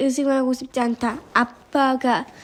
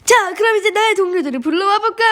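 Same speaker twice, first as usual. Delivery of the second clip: raised, higher taunting announcement; showy, higher-pitched challenge; called out; 6 semitones higher, 3 dB louder than usual. A narrator's reading. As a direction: call across to the robbers. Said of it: "유적 도둑들을 향해 외쳤어요".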